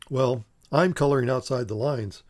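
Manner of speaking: usual speaking voice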